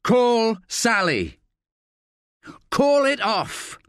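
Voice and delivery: high, slow pressing demand; urgent plea. A narrator's reading. Staff pressing Johnson to call off Sally, urgent and slow. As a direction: push him hard to call it off. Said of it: "pleads Staff".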